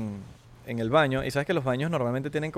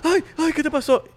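much higher, louder overdone fretting; fussing parent; 13 semitones higher, 6 dB louder than usual. Retold or imitated. Panicky parent fussing over child's bump, high and overdone.

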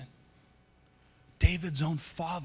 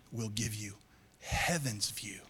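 whispered heaven's view; 6 semitones lower, 8 dB quieter than usual. Real, not acted. Soft sermon climax.

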